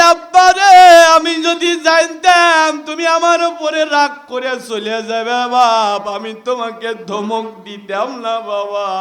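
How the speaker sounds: wailing apology. Acted. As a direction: start with a howl and sink to a sob-like chant. Son at father's feet.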